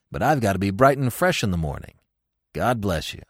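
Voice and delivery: calm, low producer brushing it off; low George voice. Acted. George on the phone brushing off Wooster, low and calm.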